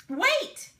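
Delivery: sudden high loud call; crying out. A narrator's reading. Sam calling back the clubs heading to wagons, loud cry. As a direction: stop everyone in their tracks. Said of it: "Sam cried".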